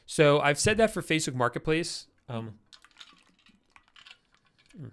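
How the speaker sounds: talking around an ice cube; mouth full. Real, not acted. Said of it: "I got an ice cube in my mouth".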